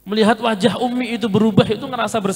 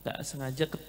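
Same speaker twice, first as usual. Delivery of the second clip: hushed, humble apology to his mother; soft apology; 6 semitones lower, 11 dB quieter than usual. Retold or imitated. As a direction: say sorry softly, head bowed. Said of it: "saya langsung minta maaf".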